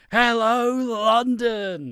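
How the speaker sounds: loud high call to the crowd; rock star crowd call. Retold or imitated. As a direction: belt it to a stadium crowd. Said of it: "talking to a crowd and be like".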